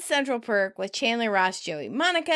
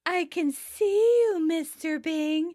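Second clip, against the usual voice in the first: slow, higher teasing voice; drawn-out mock seduction; lightly voiced creepy caller; creepy prank caller; 7 semitones higher, slower than usual.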